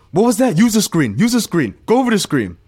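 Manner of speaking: high, loud angry commands; angry yell. Retold or imitated. Josh yelling at the defender, high angry yell. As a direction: imitate an irritated player barking. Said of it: "And Josh said".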